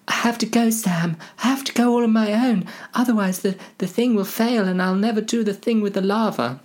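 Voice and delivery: high, hurried hobbit voice; pleading Frodo. Acted. Frodo tells Sam he must go, high voice. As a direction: explain urgently in a high put-on voice.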